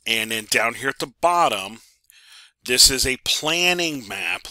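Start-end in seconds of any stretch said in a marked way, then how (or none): 2.6-4.5 slow, deliberate "planning map", each word leaned on; drawn-out emphasis on "planning map"; stress on planning map. Shown as a demonstration. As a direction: spell out "planning map" so it sinks in. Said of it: "Let me emphasize the word planning map".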